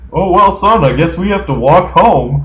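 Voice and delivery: high, loud mock-cheery dad voice; voicing Peppa's dad left behind, loud and high; resigned cartoon dad. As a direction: play the stranded dad, shrugging it off loudly.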